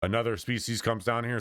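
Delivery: usual speaking voice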